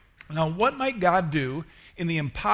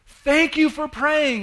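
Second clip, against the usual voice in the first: much higher, louder and slow, bursting with joy; excited thanks; 12 semitones higher, 9 dB louder, slower than usual. Retold or imitated. Woman thanking her pastor, overjoyed and loud. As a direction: pour out the gratitude. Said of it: "She was so bright and excited".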